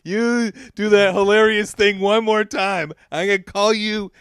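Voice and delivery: high, loud mock threat. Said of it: "what kind of situation".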